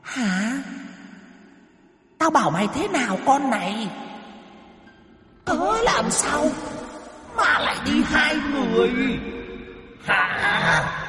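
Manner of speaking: high, eerie demon interrogation; cold demon voice. A narrator's reading. Demon berating the woman who brought someone along, cold high-pitched menace. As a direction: scold as a cold spirit in a very high voice. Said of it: "Âm thanh lạnh lẽo".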